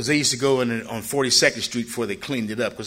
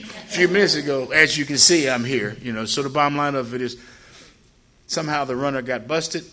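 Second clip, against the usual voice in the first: ordinary talk, nothing marked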